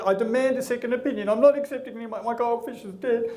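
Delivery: high, worked-up mock demand; hammed-up distraught protest; indignant owner. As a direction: insist frantically, refusing to accept it. Acted owner demands second opinion.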